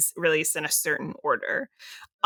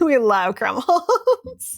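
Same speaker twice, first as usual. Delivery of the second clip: laughing; 7 semitones higher, 6 dB louder, much slower than usual. Real, not acted. Laughing affection for actor.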